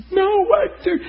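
very high, strained cry of disbelief; Randy crying out as the young man walks away, high and loud; emotional outburst. Retold or imitated. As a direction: voice cracks upward in distress. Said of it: "He got emotional and started to cry".

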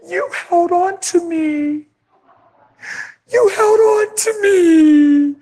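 high, slow crying plea; tearful cry. Retold or imitated. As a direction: break into sobs on it. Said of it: "she just starts crying".

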